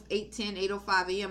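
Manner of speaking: ordinary delivery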